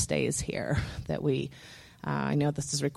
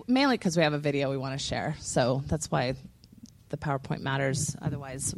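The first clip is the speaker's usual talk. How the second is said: ordinary delivery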